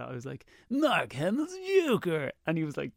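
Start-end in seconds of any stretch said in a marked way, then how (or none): 0.7-2.3 slow, high failed Joker voice; a badly faked Joker. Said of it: "I was terrible at it".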